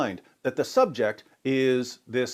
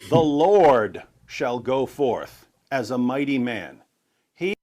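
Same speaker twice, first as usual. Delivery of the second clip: scripture reading; 5 dB louder, slower than usual. Real, not acted. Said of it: "Isaiah forty-two thirteen".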